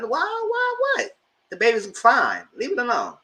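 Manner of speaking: high-pitched, exasperated imitation of oneself; mock indignant retort. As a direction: retell a comeback with playful indignation. Retold retort defending the pregnancy.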